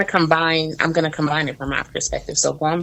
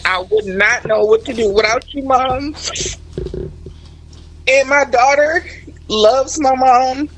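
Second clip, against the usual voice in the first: holding back tears; 6 semitones higher than usual. Real, not acted. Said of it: "in the studio crying".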